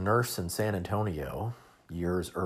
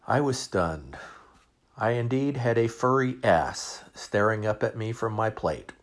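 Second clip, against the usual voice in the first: usual speaking voice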